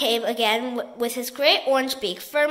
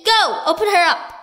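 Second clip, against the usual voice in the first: commanding shout; 4 semitones higher, 7 dB louder than usual. A narrator's reading. Duke commanding.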